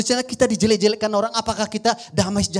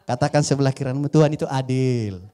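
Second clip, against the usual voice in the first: normal talking voice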